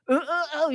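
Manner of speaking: halting mock reading in grunts; vowelless reading demo. Shown as a demonstration. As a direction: stammer out consonants without any vowels. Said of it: "이렇게 써 있는 거예요".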